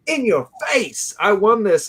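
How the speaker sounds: imitated triumphant crow, high and drawn out at first; mock a gloating winner's high-pitched taunt; gloating boast. Host imitating his friend bragging about winning, gloating.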